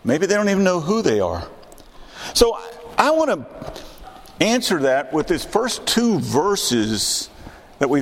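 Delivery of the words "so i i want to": normal talking voice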